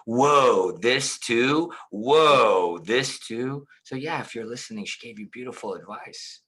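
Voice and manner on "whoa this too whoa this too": mock surprise. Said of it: "I go".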